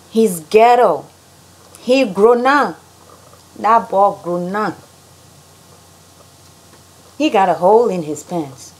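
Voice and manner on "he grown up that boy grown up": slow Liberian English drawl; Liberian accent. Shown as a demonstration. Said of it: "how Liberians say things".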